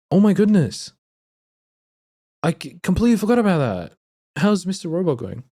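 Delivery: high, loud, gushing excitement; excited. Real, not acted. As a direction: burst out excitedly to a friend. Suddenly remembers friend's show, excited.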